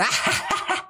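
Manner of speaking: real high-pitched giggle burst; high cackling laugh. Real, not acted. Host cracks up at the Saudi oil story, cackling.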